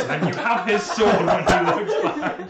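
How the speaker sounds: slow, loud, high, laughing narration. Real, not acted. Game master on the stolen sword, laughing. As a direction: crack up while telling it.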